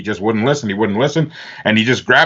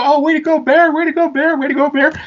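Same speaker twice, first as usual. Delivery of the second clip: high-pitched gushing congratulations; mock crowd of well-wishers, very high; imitated cheering crowd. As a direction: chant it like admiring friends. Imitates hometown crowd congratulating him.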